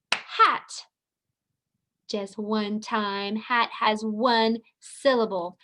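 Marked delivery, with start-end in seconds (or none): 0.1-0.8 Hat said high as one single beat; one clapped beat. Shown as a demonstration. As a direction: clap Hat once, high. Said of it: "How many times did we clap?".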